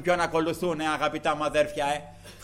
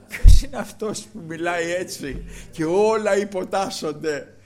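louder, slow, wondering question; amazed question; 3 semitones higher, 8 dB louder than usual. Acted. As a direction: ask in awe, drawing it out. Disciples marvel among themselves. (δυνατή, αργή απορία με δέος)

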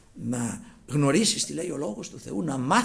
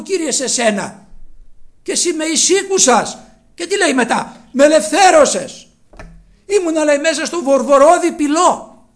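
high, loud exultant psalm; fervent preaching; 10 semitones higher, 12 dB louder than usual. Real, not acted. Preacher crying out David's psalm, high and loud. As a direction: proclaim it with full fervour.

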